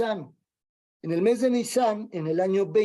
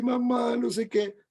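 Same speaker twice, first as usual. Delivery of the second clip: put-on a touch of a child starting to cry, pitched up; mock crying child; 4 semitones higher than usual. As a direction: lightly imitate a child about to cry. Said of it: "empieza a llorar así". (niño que empieza a llorar, apenas insinuado)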